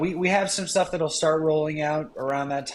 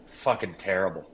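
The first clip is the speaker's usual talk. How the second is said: low, deadpan verdict on a putt; imitated deadpan; 8 semitones lower, 4 dB quieter than usual. Golf buddy judging a putt as he walks off, deadpan. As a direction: imitate a friend's dry, low put-down. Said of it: "completely deadpan at that time".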